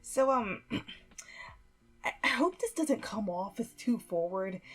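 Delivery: hesitant start with a throat clear; halting, nervous false starts; nervous stutter. A narrator's reading. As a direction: stumble into it, clearing your throat. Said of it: "he stuttered before clearing his throat".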